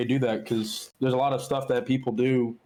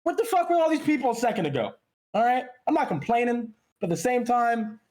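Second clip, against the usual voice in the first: high-pitched, worked-up griping; complaining; 9 semitones higher than usual. Real, not acted. Streamer telling co-host about sudden new followers, complaining. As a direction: gripe in a raised pitch, as if put out. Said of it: "I sound like I'm complaining right now".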